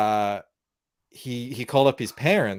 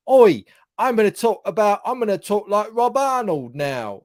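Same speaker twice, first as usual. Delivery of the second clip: higher, loud mock-British bellow; mock British accent; 10 semitones higher, 5 dB louder than usual. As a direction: exaggerate a bad Australian accent that comes out British. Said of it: "He sounds like a British".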